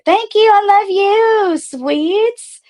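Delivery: high, sing-song thank you and love you; thanking Sweets in a high cutesy voice. Real, not acted. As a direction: gush at a donor in a squeaky voice. Thanking donor Sweets affectionately.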